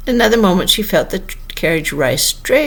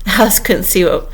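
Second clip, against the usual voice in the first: ordinary delivery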